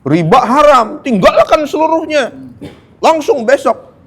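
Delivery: high, loud glib order to quit; mock preacher command. Preacher parodying glib preachers banning usury, high mocking order. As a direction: rattle off the easy command mockingly. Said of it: "Tuh, enak kan ngomongnya ya?".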